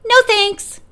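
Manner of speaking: high, calling brush-off; called out. A narrator's reading. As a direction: call it out in passing. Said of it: "called Robin".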